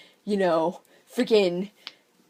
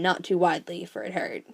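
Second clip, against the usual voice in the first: ordinary delivery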